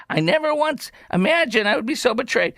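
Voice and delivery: high, whiny parody of a complaint; exaggerated wounded outrage; mock lament. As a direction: read it with hammed-up hurt. Mimics the betrayed complainer again.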